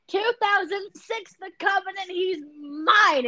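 high, slow, shouted claim; possessive claim. Retold or imitated. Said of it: "I was like".

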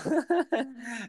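high burst of laughter; laughing. Real, not acted. Laughing while retelling the sister's blunt answer.

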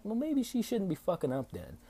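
high-pitched mock comeback; retold indignant retort. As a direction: fire back at brother, pitch way up.

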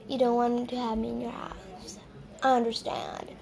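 very soft, near-whispered retelling; low mock-hurt murmur; hurt, hushed. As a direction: imitate a wounded friend, barely voiced. Friend's hurt reply acted.

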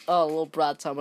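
ordinary talk

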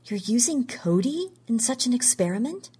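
plain reading voice

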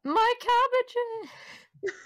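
high, loud cabbage-seller wail; mock anguished cry. Player reacting to a flung cabbage, hammed-up outcry.

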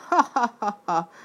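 loud, high clown guffaw; rolling laughter. Acted. Arlecchino roaring with laughter at lovesick Piero. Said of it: "gurult a nevetéstől Arlecchino".

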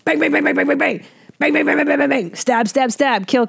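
rapid high "bang, bang" imitating gunfire; mimicked gunshots. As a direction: rattle off the movie's gunshots and stabs like sound effects. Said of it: "I just hear".

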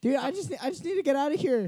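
high-pitched, exasperated begging; exasperated plea. Acted. Trapped leprechaun begging to get out, exasperated plea. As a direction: plead to be freed, pitch way up.

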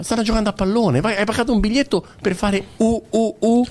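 high voice mimicking a jeering fan; mock stadium fan. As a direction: ape the fan's taunt, pitched high. Mocking fans making monkey noises.

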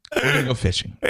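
mock badass bravado; tough guy. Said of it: "Trying to sound like a badass".

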